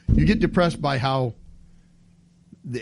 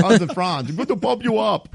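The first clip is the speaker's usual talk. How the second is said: a hammed-up, lightly pitched-up Austrian accent; playful Austrian accent, slightly higher; mock Austrian; 6 semitones higher than usual. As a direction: pump them up like a cartoon Austrian bodybuilder.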